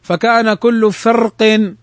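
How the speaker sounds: thick, dark ر in فرق; drawn-out recitation with the ر of فرق made heavy. Shown as a demonstration. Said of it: "بالتفخيم".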